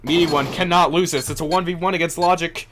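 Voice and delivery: rising hype play-by-play, high and loud; esports caster. Real, not acted. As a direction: call the clutch with mounting excitement.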